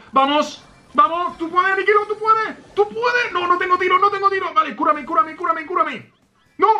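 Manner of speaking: very high-pitched desperate pleading; frantic urging. Real, not acted. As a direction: squeal urgent orders to a teammate. Frantic urging of teammate in fight.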